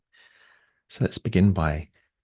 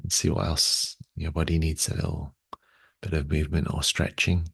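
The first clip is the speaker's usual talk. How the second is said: unhurried, slightly lowered meditation voice, long pauses; guided meditation. Real, not acted. Guides body awareness and breathing.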